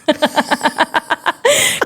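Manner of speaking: high-pitched laugh; amused, high giggle. Real, not acted. Amused at own past silence.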